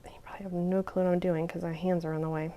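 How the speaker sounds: soft whispered aside; whispering. Real, not acted. Muttering aside while working.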